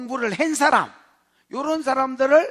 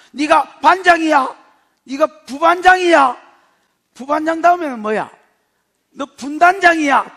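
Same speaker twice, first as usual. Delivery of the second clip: loud, higher, taunting questions; mocking shout; 3 semitones higher, 7 dB louder than usual. Preacher mocking apostles with classroom ranks, loud taunting. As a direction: rub their nose in it.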